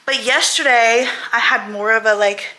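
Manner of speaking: ordinary talk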